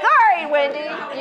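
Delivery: slow, laughing self-address; laughing while telling herself to calm down; mock pep talk. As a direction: cheer yourself on, half-joking. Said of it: "I'm like".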